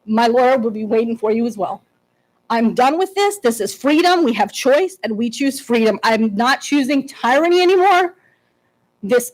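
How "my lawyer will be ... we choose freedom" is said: ordinary talk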